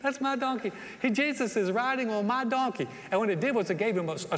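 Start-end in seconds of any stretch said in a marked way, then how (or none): 0.0-2.9 higher, bright-eyed boast about his donkey; donkey owner bragging as Jesus rides by, higher and excited; proud excited owner. Retold or imitated. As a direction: show off like a thrilled kid. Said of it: "He's like".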